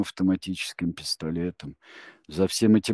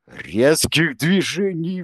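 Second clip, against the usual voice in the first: loud, high, slow cry; stretched pleading shout; appealing cry; 9 semitones higher, 8 dB louder, slower than usual. A narrator's reading. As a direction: plead out to stop them. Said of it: "воззвал Изя".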